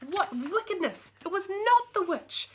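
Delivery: high, loud astonished exclamation; shocked reveal. A narrator's reading. Narrator telling the children the shocking twist, high and loud. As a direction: gasp it out as the twist lands.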